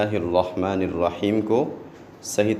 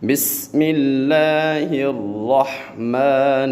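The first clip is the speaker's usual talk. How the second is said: slow recitation; 4 semitones higher, much slower than usual. Shown as a demonstration. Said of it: "teacher recites".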